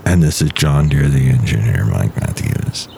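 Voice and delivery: very low, slow, dopey voice; deep goofy engineer; deep character voice. Acted.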